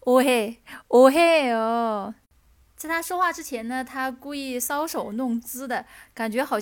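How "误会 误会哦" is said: drawn-out sweet plea, slow; coy pleading. Shown as a demonstration. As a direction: stretch it coyly, slow. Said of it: "就很 嗲，然后就让人心都融化的那种". (慢慢撒娇)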